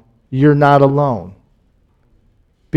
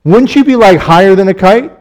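excited; 5 semitones higher, 5 dB louder than usual. Real, not acted. Preacher asks the crowd.

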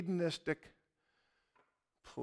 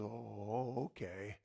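very low, hushed "oh, okay"; subdued acceptance; 8 semitones lower, 12 dB quieter, slower than usual. Retold or imitated. Gym guy accepting the preacher's refusal, subdued. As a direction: give in quietly, almost under the breath. Retold man's deflated reply to refusal.

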